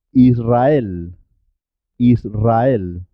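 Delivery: trilled R after the s in "Israel"; double R after S. Shown as a demonstration. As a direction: roll "Israel" as Is-rrael. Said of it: "pero suena doble".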